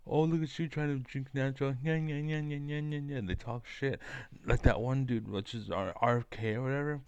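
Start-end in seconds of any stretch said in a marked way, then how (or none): 0.1-3.2 higher, sing-song nagging mockery; mocking taunt. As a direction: imitate sneering rich people with a childish nyah-nyah. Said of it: "they go and talk shit about us".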